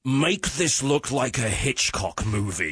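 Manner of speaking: slow, grandly theatrical; mock director. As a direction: ham it up like a pompous director. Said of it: "you can imagine him saying".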